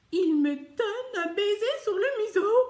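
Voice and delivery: high, loud, thrilled storytelling; émerveillement joué. Acted. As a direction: burst with delight as you tell it. Donkey thrilled by Jesus's kiss. (ravissement enfantin, aigu et fort)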